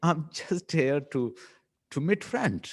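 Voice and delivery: very slow, high, short English reply; slow clipped reply. Shown as a demonstration. Teacher demonstrating clipped English to a student, slow and high. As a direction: drag out a bare content-word answer. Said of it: "ऐसे ही बोला जाता है".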